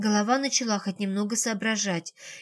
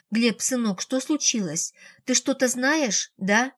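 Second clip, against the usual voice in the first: usual speaking voice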